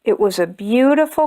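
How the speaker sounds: plain reading voice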